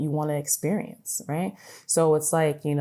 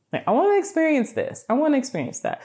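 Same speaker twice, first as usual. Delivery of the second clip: eager wishes; 11 semitones higher, 3 dB louder than usual. Retold or imitated. Acting out wishes.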